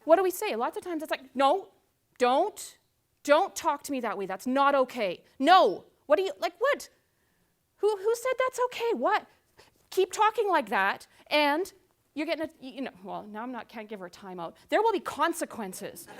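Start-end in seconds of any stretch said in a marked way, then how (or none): 1.3-12.5 scolding parent. Retold or imitated. Parent scolding teenager for talking back, indignant. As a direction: shut down the back-talk.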